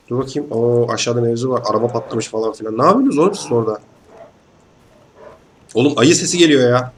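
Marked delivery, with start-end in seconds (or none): none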